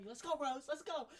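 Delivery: high-pitched hype cheer; nervous pep-up cry; psyching up. Retold or imitated. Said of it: "trying to amp yourself".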